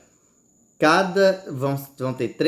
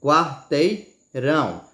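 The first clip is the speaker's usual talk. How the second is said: quarteirão lento, RÃO destacado; tônica na última; slower than usual. Shown as a demonstration. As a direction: alongar "Quarteirão" e apoiar no RÃO final. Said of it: "Não é bem mais forte?". ("Quarteirão" pausado, com força no RÃO)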